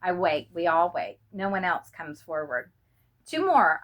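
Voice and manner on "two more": a croaked call, pitched higher; croaking. A narrator's reading. Sergeant calls for two more volunteers, croaking. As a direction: rasp the words out hoarsely. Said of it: "I croak".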